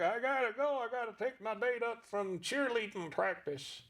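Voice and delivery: put-on a lecherous old codger bragging; Pops puppet voice. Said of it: "he said".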